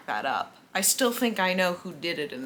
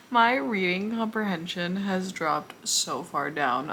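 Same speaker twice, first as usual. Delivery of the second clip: ordinary delivery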